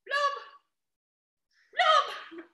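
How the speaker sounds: high playful blubs ending in a laugh; mock fish noise, lightly. Shown as a demonstration. Teacher models a fish sound for the kids, playful blubbing. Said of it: "Everybody say".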